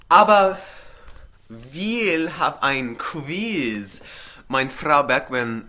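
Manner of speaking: mock teacher voice, high and drawn out; imitating German teacher. Vlogger mimicking her German teacher, mock teacher voice. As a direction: play the German teacher announcing a quiz. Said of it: "she always says that".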